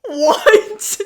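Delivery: laughing disbelief. Retold or imitated. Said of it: "the corpse is like".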